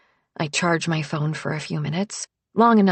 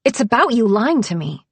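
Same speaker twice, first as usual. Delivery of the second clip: louder sharp accusation; snapping; 5 semitones higher, 6 dB louder than usual. A narrator's reading. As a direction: snap it at her. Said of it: "I snap".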